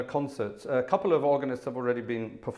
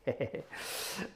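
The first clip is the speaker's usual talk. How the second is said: short, soft, breathy chuckle; 9 dB quieter than usual. Real, not acted. Host amused at his own cheeky joke, soft chuckle. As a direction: chuckle quietly to yourself.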